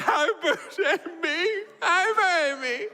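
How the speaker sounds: very high, laughing imitation of a sobbing toddler; a father retelling his son's meltdown as a sob; mock toddler crying. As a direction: wail it face down like a crying two-year-old. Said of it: "just crying, crying distress".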